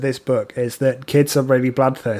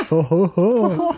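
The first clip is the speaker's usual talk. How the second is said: Santa ho ho ho; 3 semitones higher, slower than usual. Acted. Jolly Hogfather laugh.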